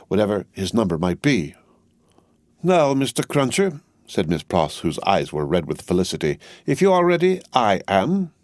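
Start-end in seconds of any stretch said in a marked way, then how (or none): 2.6-3.8 brisk, higher spinster voice; a no-nonsense housekeeper taking charge; Miss Pross. A narrator's reading. Miss Pross rallying Jerry to go marketing, brisk and high. 6.7-8.3 brisk, higher spinster voice; a no-nonsense housekeeper taking charge; Miss Pross. A narrator's reading. Miss Pross rallying Jerry to go marketing, brisk and high.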